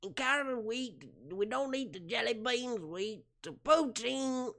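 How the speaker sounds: high, hammed-up Canadian comedian voice; Bill Cosby. Streamer failing at Canadian version of comedian, high impression. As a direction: stretch the last words in a high mock-Canadian impression. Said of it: "I can't even do it".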